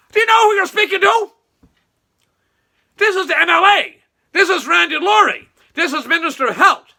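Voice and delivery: high, loud pompous mimicry of Randy; mocking Randy. Mocking Randy as he demands respect from the worker, high and loud. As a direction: puff up like a self-important politician. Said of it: "he told individual".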